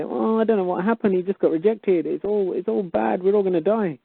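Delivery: high, whiny mocking voice of a hopeless caller; mock panicky caller. Mocking callers who ring up the show, high and whiny. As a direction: play the caller as a helpless moaner. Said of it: "these are the people who ring up the show and just say".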